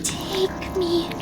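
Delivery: high, soft eerie whisper of a doll; faint ghostly doll voice. Acted. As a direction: breathe it like a dying ghost. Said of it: "I hear a faint voice".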